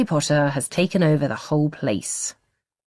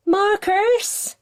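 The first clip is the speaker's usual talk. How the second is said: calling out. A narrator's reading. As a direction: call him from downstairs like a mum. Mum calls from downstairs.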